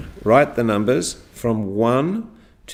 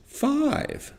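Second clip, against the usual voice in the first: "five" leaping very high, softer; rising intonation; 6 dB quieter than usual. Shown as a demonstration. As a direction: send "five" way up in pitch. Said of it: "say it like this".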